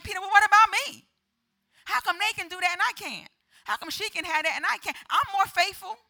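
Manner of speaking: loud, high-pitched protest of unfairness; indignant complaint. Acted. Believer protesting to God that others get blessed, indignant.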